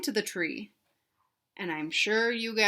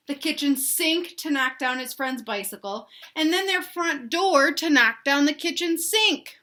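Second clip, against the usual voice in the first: put-on reading to children; 4 semitones higher, 5 dB louder than usual. A narrator's reading. Teacher reading the list to kids, building storybook excitement. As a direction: pile each item on, climbing in excitement. Teacher reads picture book aloud.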